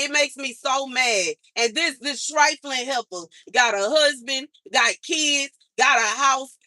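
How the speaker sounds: ordinary talk, nothing marked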